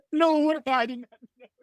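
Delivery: high-pitched, through real laughter; laughing disbelief. Real, not acted. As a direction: laugh it out, amazed. Host laughs at guest's joke.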